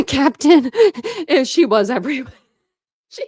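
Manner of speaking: high, loud, breaking up with laughter; cracking up mid-sentence; real laughing fit. Real, not acted. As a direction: laugh helplessly through the words. Breaks up laughing at chat comment.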